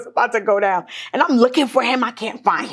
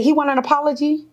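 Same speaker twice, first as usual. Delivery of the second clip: pitched-up sarcastic question; mock question; 6 semitones higher than usual. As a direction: retell it as a scornful question. Mocking the board's demand.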